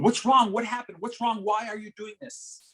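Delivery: high-pitched imitation of fretting teachers. Imitating teachers questioning a crying student, fretting.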